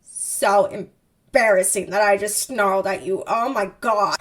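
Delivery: slow, mortified groaning delivery; hammed-up cringing embarrassment; acted embarrassment. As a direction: drag it out as if mortified. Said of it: "Her embarrassment cuts through this".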